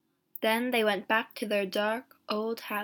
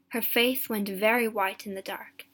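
ordinary delivery, nothing marked

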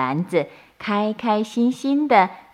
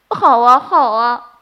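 eager agreement; 4 semitones higher, 7 dB louder than usual. A narrator's reading.

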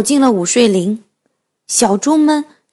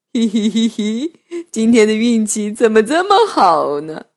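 gleeful wolf; 3 semitones lower than usual. Acted. Wolf gloats over dinner.

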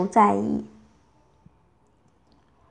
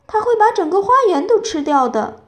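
high, loud alarm; dismayed exclamation; 8 semitones higher, 5 dB louder than usual. A narrator's reading. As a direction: exclaim in dismay over the garden. Winnie dismayed as dinosaur eats garden.

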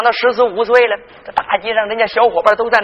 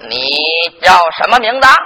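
presiding magistrate; 8 semitones lower, faster than usual. Acted. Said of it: "你爹往堂上一坐". (官老爷拖腔审问)

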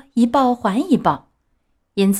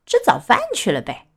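teasing, with a laugh in it; laughing reply; 4 semitones higher than usual. Acted. Schoolboy teases Pinocchio, laughing as he answers. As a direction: chuckle the answer at him. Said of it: "一个同学笑着回答说".

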